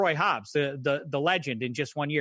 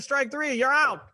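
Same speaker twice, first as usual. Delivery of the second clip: umpire call; 11 semitones higher than usual. Retold or imitated. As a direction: bark out the strikes like an umpire. Umpire calling strikes.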